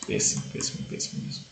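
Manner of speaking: sussurrado baixinho, quase sem voz; murmúrio envergonhado de quem se acha péssimo; whispered. Real, not acted. Self-deprecating after admitting weakness. (sussurro autodepreciativo)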